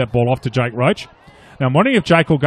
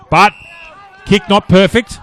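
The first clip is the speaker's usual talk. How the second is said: shouted call; 6 semitones higher, 7 dB louder than usual. Real, not acted. Live call, shout heard.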